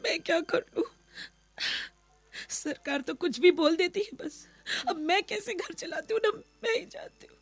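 sobbing helpless lament; crying. Acted. Wife lamenting to maid about running the house, crying. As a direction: cry through the words, breaking at the end. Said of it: "रो।".

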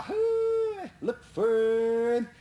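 high drawn-out call, then slow; holler. Retold or imitated. Brother Sonny urging the dogs with a holler. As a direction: call the dogs through the woods.

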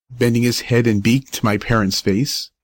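ordinary delivery, nothing marked

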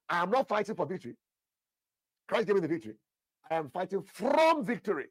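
loud confession, "from" hit hard; declaring it loudly. Shown as a demonstration. Congregation repeats the pastor's declaration, loud and bold. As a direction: proclaim it like a creed, stressing "from". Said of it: "Write it down, sir".

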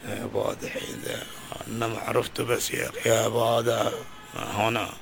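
a low, muttered brush-off; lightly voiced, tell him to leave you be; ashamed beggar. Beggar to an old friend, low embarrassed brush-off.

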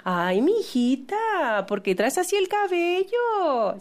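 drawn-out, high-pitched fake concern; slow, syrupy passive-aggressive fussing; criticism disguised as concern. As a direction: coo it like a meddling relative. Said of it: "esa crítica disfrazada de preocupación".

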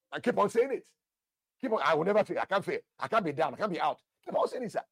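loud, high, emphatic declarations; forceful preaching. Real, not acted. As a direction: fire off bold faith claims, urging the congregation. Preacher urges bold faith declarations.

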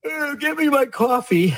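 facetious high-pitched waking-up voice; God waking up. Pretend. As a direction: play a comic just-woken God wanting coffee. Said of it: "what does God see every morning when he wakes up".

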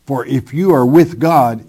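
normal talking voice

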